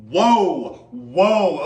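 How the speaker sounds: loud, amazed whoa; excited whoa. Real, not acted. Sees the board, calms self.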